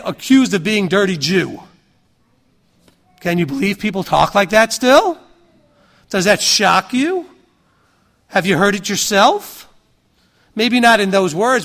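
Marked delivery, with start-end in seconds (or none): none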